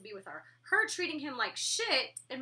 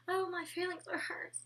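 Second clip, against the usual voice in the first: higher, mock-whiny quote; hammed-up hurt feelings, mocking; mocking whine; 7 semitones higher, 4 dB quieter than usual. Mocking roommates who complain of being woken: higher mock whine. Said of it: "You don't say".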